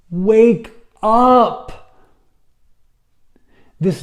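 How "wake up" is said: loud, high shout to jolt them awake; shouted wake-up call. Real, not acted. Preacher shouting to jolt sleepy listeners, loud and high. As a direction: bark it like an alarm going off.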